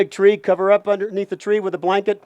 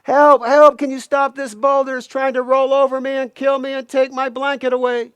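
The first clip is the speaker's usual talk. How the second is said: high-pitched acted cry for rescue; yelling for help; 5 semitones higher than usual. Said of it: "Coyote yells out".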